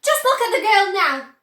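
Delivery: high, loud distressed cry. A narrator's reading. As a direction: point out the horror angrily. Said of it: "cried Missus Beauregard".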